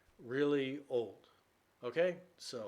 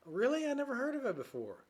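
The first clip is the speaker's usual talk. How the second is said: much higher, startled disbelief; surprised girlfriend; 13 semitones higher, 4 dB louder than usual. Acted. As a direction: react as if it's news to you.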